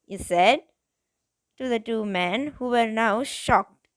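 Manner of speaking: ordinary delivery, nothing marked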